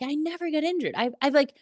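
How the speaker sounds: high-pitched, protesting self-reproach; mock indignant disbelief. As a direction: act out baffled frustration with yourself. Acting out disbelief at getting injured.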